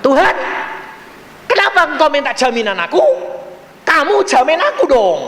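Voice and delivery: high, loud challenge; indignant retort. Retold or imitated. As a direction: throw the question back forcefully. Acts out his retort to God.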